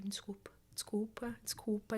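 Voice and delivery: breathy whispered desculpa, slowly; whispered apology. Retold or imitated. She apologises to him for laughing, whispering. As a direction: whisper it, slow and sorry.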